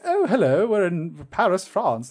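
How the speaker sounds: high, slow, posh British greeting; mock posh British, starting high; upper-class British accent. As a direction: exaggerate a genteel British toff. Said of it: "different class British".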